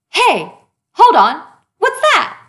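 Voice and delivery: high squeak of alarm on what's that; sudden surprise. Acted. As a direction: catch sight of the stain and yelp. Character spots jelly stain.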